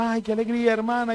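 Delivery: high, soft-voiced sister greeting; imitated gushing churchwoman. Church sister greeting after the message, imitated gushing. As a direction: lightly mimic an effusive greeting. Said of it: "la hermana de pico babión".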